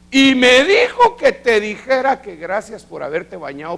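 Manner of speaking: high, slow, laughing delivery; excited report. Retold or imitated. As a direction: share the amazing news, delighted. Joyful report of grandfather's message.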